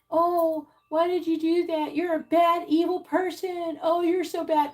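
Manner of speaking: exaggerated guilt-tripping voice; mock accusing whine. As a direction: parody someone crying foul at a boundary. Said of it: "they're gonna say to you".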